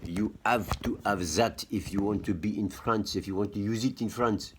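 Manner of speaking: put-on French-accented English. Telling viewers what France requires, in a mock French accent. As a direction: say it as a Frenchman would. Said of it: "You hear, like, my French accent".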